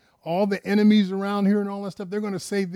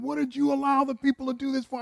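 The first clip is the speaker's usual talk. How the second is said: much higher, rebuking question; rebuking Moses; 9 semitones higher, faster than usual. Retold or imitated. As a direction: Moses scolding Aaron in a high voice.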